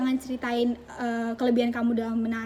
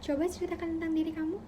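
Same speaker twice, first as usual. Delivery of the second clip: higher, softer interviewer's question; interviewer asking; 5 semitones higher, 5 dB quieter than usual. Acted. Interviewer asking a candidate to introduce themselves, higher soft voice. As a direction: pose it softly, pitched up evenly. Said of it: "ketika kalian ditanya".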